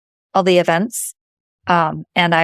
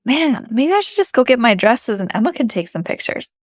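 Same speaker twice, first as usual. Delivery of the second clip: ordinary talk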